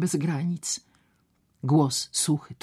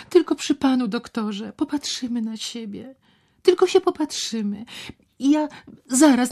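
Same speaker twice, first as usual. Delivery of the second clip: put-on pleading young woman; 9 semitones higher, 6 dB louder than usual. Ewa begs, higher voice.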